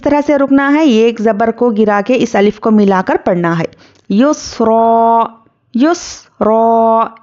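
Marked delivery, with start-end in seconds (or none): 4.1-7.1 युसरा chanted very slowly, final vowel held long; slow recitation. Shown as a demonstration. Teacher models the word for pupils, slow chant. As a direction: recite युसरा twice, drawn out and measured. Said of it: "इस तरह से पढ़ना है".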